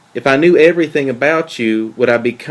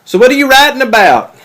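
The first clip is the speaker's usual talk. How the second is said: raised voice carried from the next room; louder, higher shout from off in the bathroom; calling from another room; 12 semitones higher, 9 dB louder than usual. Acted. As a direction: call it out through the door so she hears.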